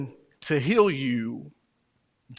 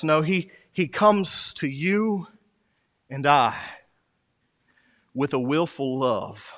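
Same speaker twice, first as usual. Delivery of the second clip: ordinary talk, nothing marked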